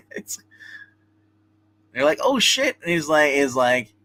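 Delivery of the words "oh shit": high-pitched retold exclamation; mock alarm. Guards see he goes nuclear, mock alarm. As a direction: exclaim it like sudden trouble, played lightly.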